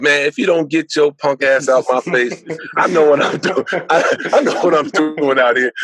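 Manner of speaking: a touch of a tough clapback, laughing at the end; mock angry retort. Young him snapping back at the ball boy, mock angry. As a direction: play the cocky top dog brushing him off. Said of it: "I would have said".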